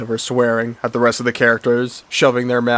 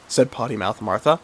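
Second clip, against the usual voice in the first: ordinary delivery, nothing marked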